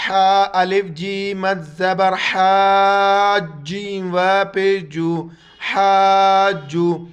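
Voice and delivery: loud, drawn-out teacher spelling; spelling drill. Shown as a demonstration. Teacher to students, loud spelling drill. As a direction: call each letter out to the class. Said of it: "اس کو بھی ایجا کرنے کا طریقہ یہ".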